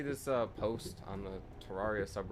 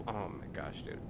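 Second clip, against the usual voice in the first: usual speaking voice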